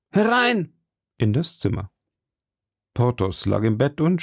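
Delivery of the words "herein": high-pitched call through a door; ringing "come in" call. A narrator's reading. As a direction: call a visitor in, pitched right up. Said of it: "trat auf den Ruf".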